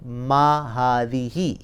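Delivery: मा हादी ही slowly spelled out, each syllable clear; slow Arabic model phrase. Shown as a demonstration. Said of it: "तो यहाँ भी आपके ये कहेंगे".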